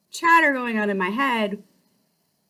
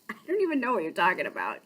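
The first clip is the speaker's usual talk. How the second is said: laughing disbelief; 2 semitones lower, 6 dB quieter, much faster than usual. Retold or imitated. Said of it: "he goes".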